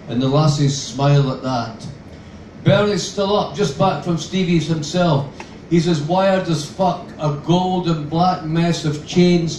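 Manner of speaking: plain reading voice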